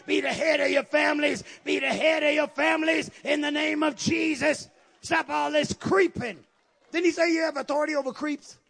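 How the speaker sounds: loud, driving pulpit exhortation; forceful sermon shout; full-force preaching. Real, not acted. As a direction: fire it at the congregation.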